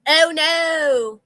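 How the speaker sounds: loud sarcastic oh no; mock dismay. Real, not acted. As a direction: gasp it sarcastically.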